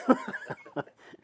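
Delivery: high breathy laugh; burst of laughter. Real, not acted. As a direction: laugh it off, amused at yourself. Laughs at her hated subject.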